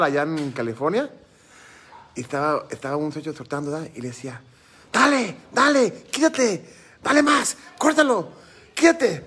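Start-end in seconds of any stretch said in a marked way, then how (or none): 4.9-9.2 high-pitched calling out, drawn-out words; imitated urging calls. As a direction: mimic someone urging on a fighting rooster from ringside. Acts out man urging his rooster.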